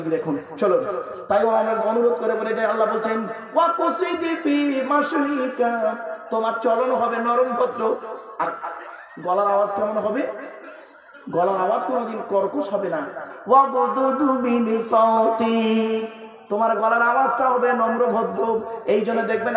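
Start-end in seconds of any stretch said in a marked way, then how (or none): 3.5-6.0 slow, higher chanted verse; Quran recitation. Shown as a demonstration. 13.5-16.2 very slow chanted Arabic; Quran recitation. Shown as a demonstration.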